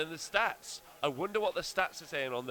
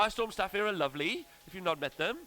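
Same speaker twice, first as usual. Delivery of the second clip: higher Welsh lilt; Welsh accent; 4 semitones higher than usual. Pretend. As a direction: lay on the Welsh. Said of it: "Rob's amazing Welsh accent".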